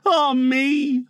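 high, sustained mournful sigh of words; lament. Acted. Boy mourns Wendy, plaintive lament.

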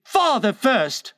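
put-on high, slow child's insistence; suspicious small boy. A narrator's reading. Son distrusting his father, suspicious boy's voice. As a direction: echo it back as a stubborn boy. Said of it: "who was of a suspicious nature".

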